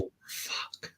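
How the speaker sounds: whispered curse under the breath; whispered dismay. Real, not acted. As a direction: whisper it as the vote slips away. Losing a vote to his rival.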